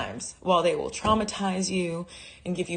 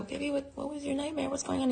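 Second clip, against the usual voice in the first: hushed worry; 8 semitones higher, 8 dB quieter, faster than usual. Retold or imitated. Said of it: "So I go".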